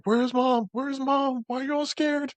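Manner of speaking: very high, put-on voice; high-pitched character impression, held all through; Charlie's voice. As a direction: imitate someone calling out in a tiny high pitch. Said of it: "in Charlie's voice".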